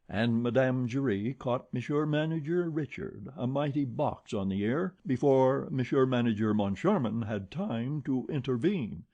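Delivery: ordinary delivery, nothing marked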